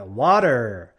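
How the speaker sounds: slow WATER as WAH-derr; American WATER with r ending; different US ending. Shown as a demonstration. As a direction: let WATER finish on a held r. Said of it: "That ending's a bit different".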